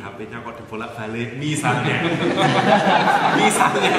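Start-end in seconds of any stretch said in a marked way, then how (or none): 2.1-3.3 loud, bright burst of laughter; real laugh. Real, not acted. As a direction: laugh at the wife example. Laughs at own example. (tawa keras)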